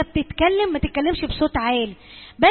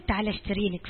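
intimate hush; 6 semitones lower, 9 dB quieter than usual. Acted. Mother confiding privately to daughter.

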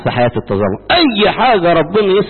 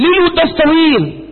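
whiny mock complaint; 12 semitones higher than usual. Mocking a complainer.

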